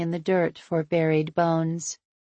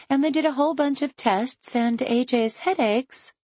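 cheerful woman; 7 semitones higher than usual. A narrator's reading. Chloe voiced, cheerful.

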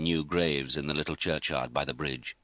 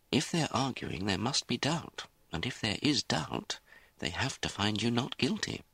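higher-pitched, reasoned explanation; lightly voiced lawyer; 6 semitones higher, 3 dB quieter than usual. Lawyer explains jury doubt to the accused, lightly voiced reassurance. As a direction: lay out the logic to reassure.

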